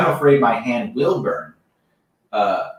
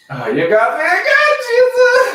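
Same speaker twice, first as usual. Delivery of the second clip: higher, louder, laughing at the prank; laughing mock Peter; 4 dB louder than usual. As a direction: play Peter, caught out and laughing. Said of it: "Peter after Jesus's prank".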